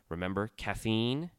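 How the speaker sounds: ordinary delivery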